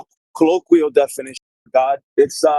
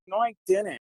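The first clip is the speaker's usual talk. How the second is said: put-on soft, higher little-girl pout; mock pouting; 4 semitones higher, 8 dB quieter than usual. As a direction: mimic him like a sulky little girl, quietly. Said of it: "you're pouting".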